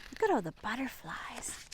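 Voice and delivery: whisper. Shown as a demonstration. Pointing at pictures, whispered.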